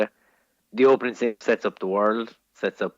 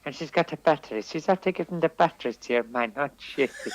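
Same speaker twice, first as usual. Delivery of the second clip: put-on higher old-lady voice narrating the film; imitating old woman commentator; 5 semitones higher than usual. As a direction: gasp and comment like a cinema gossip. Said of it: "Two fifty-something-year-old women".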